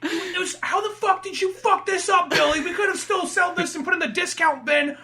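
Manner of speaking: furious high boss voice; angry boss. Retold or imitated. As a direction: chew the employee out. Angry boss yelling at employee.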